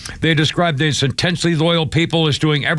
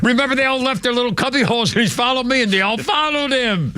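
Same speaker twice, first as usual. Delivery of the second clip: ordinary delivery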